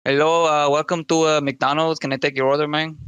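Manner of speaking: hesitant fast-food cashier with a mild Spanish accent; put-on Spanish accent, nervous on the spot. Shown as a demonstration. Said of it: "Can you do a good Spanish accent?".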